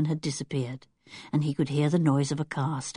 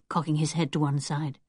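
ordinary delivery, nothing marked

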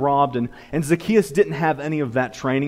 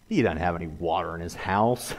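low, softer disbelieving aside; skeptical private thought; 9 semitones lower, 6 dB quieter than usual. Acted. Listener privately doubting the neighbor's boast, low skeptical aside. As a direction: drop it low, as if thinking to yourself.

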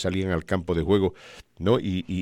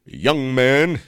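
put-on old announcer voice; 6 semitones higher, 6 dB louder than usual. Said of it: "y me dice".

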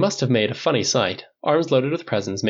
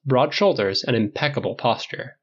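plain reading voice

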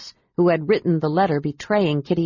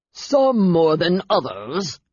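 slow growl; slower than usual. A narrator's reading. Lucian to the Dark Elf, a slow menacing growl. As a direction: growl it out slowly like a resentful rival. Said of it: "growled Lucian".

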